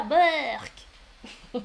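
mock "yuck" of distaste; playful disgust. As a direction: blurt it out in comic disgust. Jokes about pineapple on pizza.

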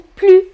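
"plus" as plu, high and loud; teacher models "plus" with the S dropped; silent final S. Shown as a demonstration. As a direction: say "plus" ending on the vowel, no S. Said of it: "on ne prononce pas le S".

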